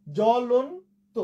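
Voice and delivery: জ্বলন্ত stretched as jo-lon-to with silent ব; slow pronunciation demo. Shown as a demonstration. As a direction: draw out জ্বলন্ত, lifting the pitch on lon. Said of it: "ব ফলার উচ্চারণ হলো না". (জ্বলন্ত ধীরে, ব ছাড়া উচ্চারণ)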